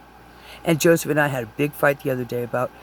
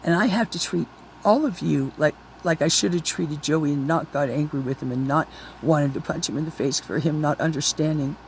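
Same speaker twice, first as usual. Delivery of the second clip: normal talking voice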